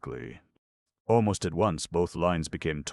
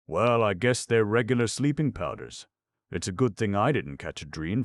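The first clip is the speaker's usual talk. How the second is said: higher, louder lion voice; lightly voiced lion; 6 semitones higher, 5 dB louder than usual. Lion inspects dream packages, lightly voiced lion.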